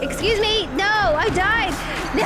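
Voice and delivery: high, loud gamer outcry; outraged protest. Real, not acted. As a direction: wail in indignation at losing. Protests dying in game.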